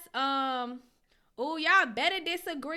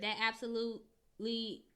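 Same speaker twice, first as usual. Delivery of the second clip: normal talking voice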